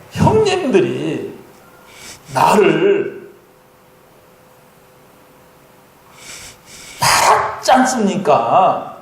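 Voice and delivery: slow, halting Joseph. Acted. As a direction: drag out each word as Joseph. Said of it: "요셉이가". (요셉처럼 아주 천천히)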